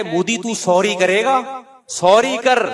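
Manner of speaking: slow, then bark out the order; army chief. Retold or imitated. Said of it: "army chief کہے". (Army chief کی نقل، حکم دیتے ہوئے)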